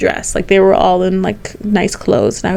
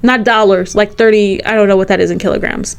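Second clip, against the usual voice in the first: ordinary talk, nothing marked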